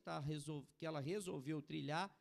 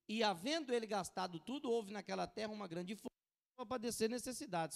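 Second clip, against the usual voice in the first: loud, declaimed Bible verse; ringing pulpit reading; forceful scripture reading; 3 semitones higher, 6 dB louder than usual. Acted. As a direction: proclaim the passage to the congregation.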